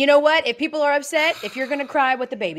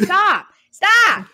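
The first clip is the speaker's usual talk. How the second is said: loud stop; 11 semitones higher, 8 dB louder than usual. Real, not acted. Scolding Texas football hype.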